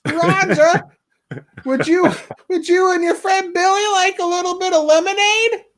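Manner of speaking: sing-song mother offering lemonade; mom calling downstairs. Retold or imitated. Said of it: "when his mom yells down".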